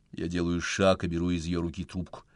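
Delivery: ordinary delivery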